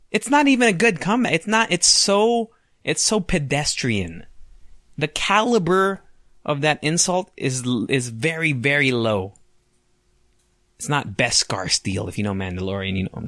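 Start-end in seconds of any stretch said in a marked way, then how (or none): none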